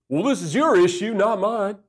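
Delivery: mock defensive retort. Husband reverting to blaming his wife, mock defensive. Acts defensive reply he rejects.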